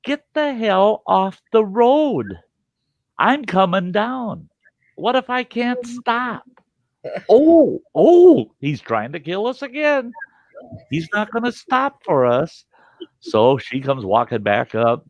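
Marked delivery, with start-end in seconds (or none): 0.0-6.4 high, slow shouting out the window; dad yelling a warning out of the car window; retold yell. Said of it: "I yell out the window". 7.3-12.7 high, loud "oh, oh" then a grumble; ex-wife complaining about the driving dad; mock panic. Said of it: "wife voiced in story".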